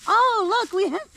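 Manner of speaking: high, loud burst of delight at finding potatoes; excited. Real, not acted. Gardener finding sweet potatoes in the bale, thrilled and loud. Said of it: "I'm very excited".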